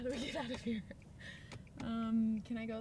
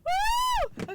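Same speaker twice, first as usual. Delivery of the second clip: high, loud cheer of joy; ecstatic whoop; 9 dB louder than usual. Real, not acted. Celebrating the news about the case file, whooping. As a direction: whoop as loud as you can. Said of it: "explain my excitement right now".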